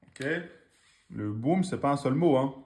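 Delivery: normal talking voice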